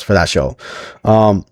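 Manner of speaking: ordinary talk, nothing marked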